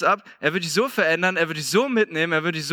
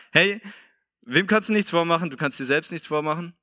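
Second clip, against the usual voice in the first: ordinary delivery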